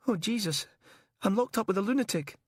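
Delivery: high, fast horrified voice; shocked and appalled. Acted. Terrified prisoner.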